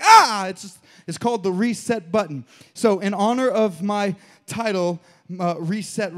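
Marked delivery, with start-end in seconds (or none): 0.0-0.5 much higher, much louder startled cry; jolted yelp. Retold or imitated. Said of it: "you're like".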